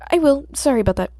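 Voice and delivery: loud eager promise. Acted. Nervous boy eagerly promises the hero to pay attention. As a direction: blurt it out quickly.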